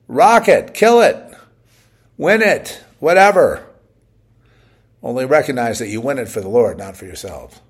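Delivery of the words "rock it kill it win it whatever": high, loud rallying cry; rousing shout. Real, not acted. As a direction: fire them up, shouting each one. Rousing call to go win.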